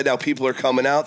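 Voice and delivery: ordinary talk, nothing marked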